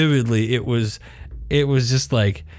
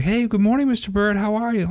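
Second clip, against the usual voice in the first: high, chirpy imitation of friendly greeters; friendly greeting; 7 semitones higher than usual. School staff greeting a passing dad, imitated friendly. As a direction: pitch it up like a hallway hello.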